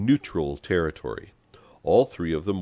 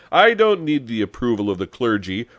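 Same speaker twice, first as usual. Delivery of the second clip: loud, haughty retort pitched up; raised-voice arrogance; arrogant; 6 semitones higher, 7 dB louder than usual. A narrator's reading. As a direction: brush them off proudly. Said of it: "replied Pepone arrogantly".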